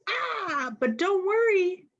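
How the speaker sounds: high, soothing don't worry; calm the child down kindly; reassuring ah. A narrator's reading. Reader soothing a child upset by messy hair, gently high.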